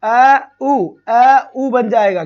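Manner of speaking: अ and उ said loud and separate; loud slow vowel demo. Shown as a demonstration. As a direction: call out अ, उ loudly to the class. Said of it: "औ". (अ और उ को ज़ोर से अलग-अलग बोलना)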